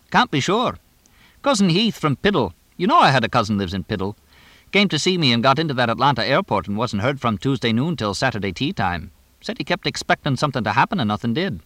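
rambling English trainer. Acted. Trainer telling of his cousin at the airport, fast chatty ramble. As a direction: ramble on briskly as the talkative trainer.

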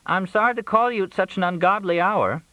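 high-pitched apologetic caller; high character voice, sorry to disturb; Crystal apologizing. A narrator's reading. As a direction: raise the voice well up for a late-night apology. Narrator voices Crystal apologizing by phone.

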